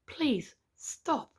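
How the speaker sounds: usual speaking voice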